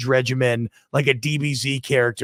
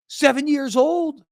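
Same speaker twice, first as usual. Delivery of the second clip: much higher, stunned repetition; amazed emphasis; 10 semitones higher than usual. Real, not acted. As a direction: say it in disbelief. Repeats in amazement.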